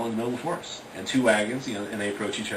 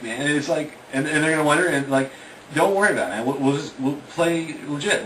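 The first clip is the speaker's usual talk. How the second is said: higher, louder, nervous pleading; anxious teen buddy; 5 semitones higher, 4 dB louder than usual. Retold or imitated. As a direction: fret that the plan will fail. Worried friend arguing against the trip.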